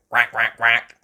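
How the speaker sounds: loud duck quacking; duck quacks. A narrator's reading. Missus Duck greeting the family, loud quacks.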